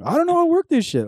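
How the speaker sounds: high, whining mock of a helpless dad; a mocking whine at the stubborn dad; whiny dad. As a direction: mimic the father complaining he's lost. Dad whining about finding a new provider.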